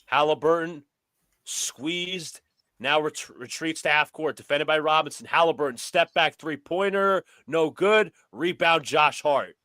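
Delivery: brisk sportscaster calling the action; play-by-play commentary. Pretend. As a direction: call it like a courtside announcer. Plays announcer over the game.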